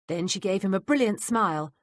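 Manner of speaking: ordinary delivery, nothing marked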